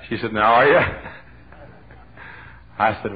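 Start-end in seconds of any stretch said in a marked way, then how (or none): 0.3-0.8 a sudden, louder pushy question; insistent demand. Retold or imitated. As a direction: press him for an answer. The lady insists.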